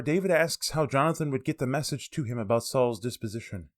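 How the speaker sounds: usual speaking voice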